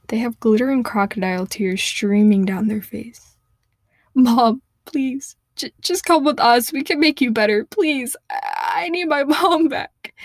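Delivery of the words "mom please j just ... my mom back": sobbing plea. Acted. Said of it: "they sob".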